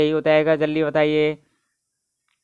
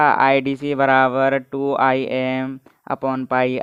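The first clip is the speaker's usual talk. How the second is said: 1 slow dictation; 3 semitones lower, much slower than usual. Shown as a demonstration. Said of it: "dictating formula slowly".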